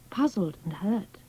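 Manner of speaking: ordinary delivery, nothing marked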